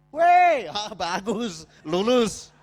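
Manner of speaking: acted triumphant whoop; delighted exclamation. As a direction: cheer a test passed. Acting out delighted text reply.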